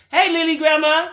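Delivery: loud, high singled-out hello; calling out greeting. Real, not acted. Streamer greets Lily Grandma joining the live, calling out. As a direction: sing out a hello, pitched well up.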